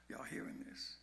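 breathy whisper to the congregation. Real, not acted. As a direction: lean in and whisper the question.

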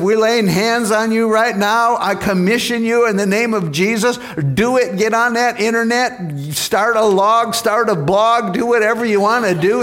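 high, emphatic preacher's charge; fervent commissioning. Retold or imitated. Pastor lays hands on a man to commission him, fervent charge.

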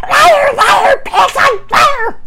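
very high, loud shriek; practically screaming. A narrator's reading. Sister accusing her brother of lying, screaming. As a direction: scream the accusation as an enraged child. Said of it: "she practically screamed".

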